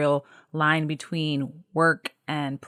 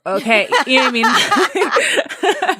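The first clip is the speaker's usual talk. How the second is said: laughing; 8 semitones higher, 7 dB louder, slower than usual. Real, not acted. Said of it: "laughing at herself".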